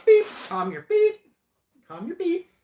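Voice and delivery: very high, squeaky self-censoring; squeaked censor beep. Pretend. Host censoring a rude word on livestream, squeaky mock bleep.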